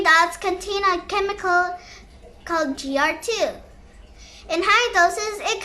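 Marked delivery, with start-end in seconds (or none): none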